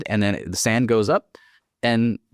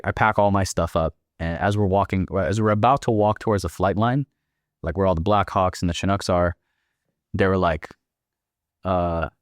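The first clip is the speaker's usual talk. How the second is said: ordinary talk, nothing marked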